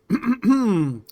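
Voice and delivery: throat clearing. Acted. Envoy clears throat before decree.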